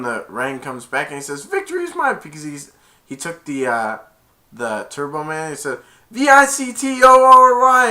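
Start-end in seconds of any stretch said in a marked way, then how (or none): 1.4-2.2 high gloating quote; villain's triumph. Retold or imitated. 6.1-7.9 loud, high victory chant; shouted mock-triumphant letters; triumphant spelling. Acting villain's triumphant spelling.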